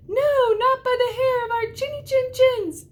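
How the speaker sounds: very high squeaky pig voices, loud; little pigs. A narrator's reading. The little pigs refusing the wolf, very high and loud. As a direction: squeal it defiantly. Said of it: "said the little pigs".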